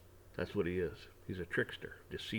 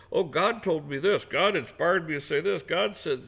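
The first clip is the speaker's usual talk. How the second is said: hammed-up boast about God's word; mock preacher voice; 5 semitones higher, 6 dB louder than usual. Mocking a know-it-all who claims God told him. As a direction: mimic a self-important preacher, louder. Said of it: "quit being a moron".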